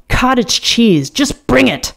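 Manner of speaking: impatient. A narrator's reading. Bateman snapping an order.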